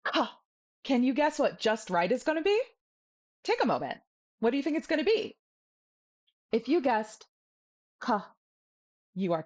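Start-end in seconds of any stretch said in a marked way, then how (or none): 0.0-0.3 "ka" with a strong puff of air; hard, breath-blasted K in "ka"; too-hard K. Shown as a demonstration. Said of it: "too hard is". 8.0-8.3 "ka" with a light puff of air; mid aspiration. Shown as a demonstration. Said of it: "It is mid aspiration".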